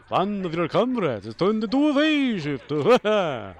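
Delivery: loud, high mock announcer; game announcer impression. Player mocking the comrades warning, loud announcer impression. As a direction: parody the announcer with big swooping pitch.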